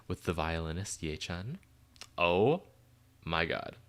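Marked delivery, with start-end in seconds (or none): none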